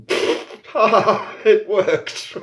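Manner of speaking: high, loud laughing gloat; triumphant laugh. Retold or imitated. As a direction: laugh it out, delighted it worked. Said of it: "stood there going".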